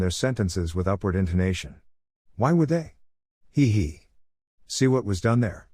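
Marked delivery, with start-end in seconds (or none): 2.4-2.9 "Why would they?" asked with a big rise in pitch at the end; upward intonation. Shown as a demonstration. Reading a speaking guide aloud, showing a rising question. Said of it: "used a couple questions and upward intonation". 3.5-4.0 giggle. Acted. Playful giggle after rhetorical question.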